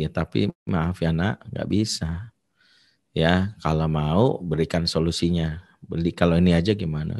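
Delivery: lebih rendah dan pelan, menolak halus; lembut menolak. Retold or imitated. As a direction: tolak permintaan anak dengan sabar. Ayah menolak anak dengan lembut. (suara ayah lembut dan rendah)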